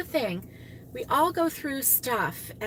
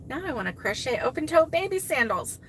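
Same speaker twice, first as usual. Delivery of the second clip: usual speaking voice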